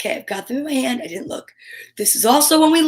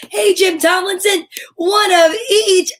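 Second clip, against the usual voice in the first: excited shout; 7 semitones higher, 4 dB louder than usual. Real, not acted.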